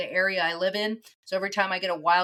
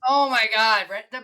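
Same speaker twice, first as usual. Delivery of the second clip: normal talking voice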